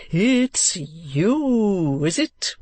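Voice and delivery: put-on high, swooping eccentric old gentleman. Old gentleman calling down to man below wall, eccentric greeting.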